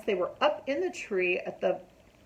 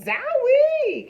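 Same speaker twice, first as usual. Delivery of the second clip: sudden cry; 9 dB louder than usual. A narrator's reading. Hammer hits his finger.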